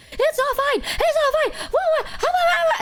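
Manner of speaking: very high-pitched mock yell; imitated outraged, sputtering yelling; Kamimi yelling. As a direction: squawk it out high, pretending fury. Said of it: "Kamimi yells and she goes like".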